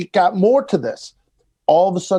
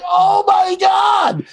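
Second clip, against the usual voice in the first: high, slow, shouted shock; shocked gasp; 3 dB louder, much slower than usual. Retold or imitated. Comic retelling the crowd's reaction to the puppet, high slow shocked shout. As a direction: squeal it out scandalised. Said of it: "and you'd be like".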